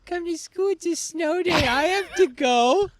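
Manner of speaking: high-pitched mimicking of a friend; mock pleading. Mimicking the snow-day demand.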